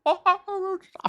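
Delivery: very high falsetto cartoon yelp; badly faked squeaky cartoon mouse; bad Mickey Mouse. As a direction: have another stab at a high mouse voice. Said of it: "uh, impression".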